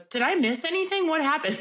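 high-pitched imitation of a nosy latecomer; eager questioning. Imitates intrusive late arrival asking her.

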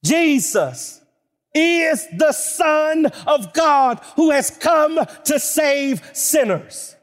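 steady, ringing pulpit delivery, slowing at the end; preaching. Acted. Preacher reciting the I am sayings, ringing proclamation. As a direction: proclaim each verse like a rising list.

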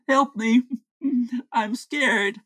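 high-pitched mock distress; feigned cry for help. Henry faking fear while secretly eating, mock cry for help. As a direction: put on a brief frightened plea. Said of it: "He is screaming help, and I'm scared".

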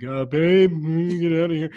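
mock call up to another floor; imitating a yell from two floors down; retold yell upstairs. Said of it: "yelling up, like, two sta-, stories".

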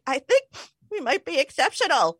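high-pitched, playful self-aggrandizing; mock self-praise. Acting out her own growing self-praise.

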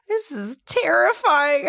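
high, slow put-on fright; mock terrified. Telling friends how scared she was, mock terrified. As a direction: ham up being scared of the cop. Retelling her scared reaction, being pulled over.

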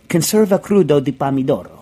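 rolling Italian pronunciation, hammed a little; Italian accent. Shown as a demonstration. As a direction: say it like an Italian chef. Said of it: "pronounce this, Italian head".

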